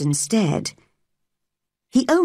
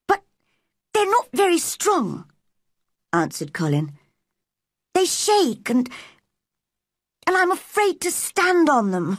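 put-on high, timid boy's voice; 10 semitones higher than usual. A narrator's reading. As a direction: voice a boy doubting his own legs. Boy fears his weak legs.

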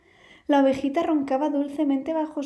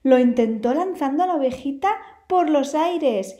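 usual speaking voice

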